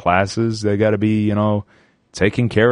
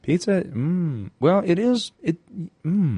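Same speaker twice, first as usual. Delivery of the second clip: grudging agreement; 7 semitones higher, 3 dB quieter than usual. Pretend. Reluctant concession, high and soft.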